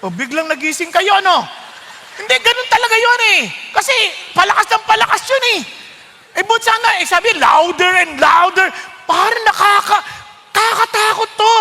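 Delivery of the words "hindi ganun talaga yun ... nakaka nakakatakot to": high, loud, fast laughing delivery; laughing excitement. Real, not acted. Preacher laughing excitedly about the louder and louder trumpet. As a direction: laugh and rush it, full of awe. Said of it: "laughing, high excited talk".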